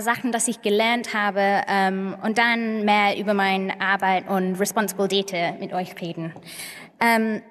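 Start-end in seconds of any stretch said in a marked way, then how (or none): none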